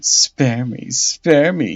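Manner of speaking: lightly mimicking the bishop's begging; mocking imitation. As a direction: parrot his plea with scorn. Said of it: "he says".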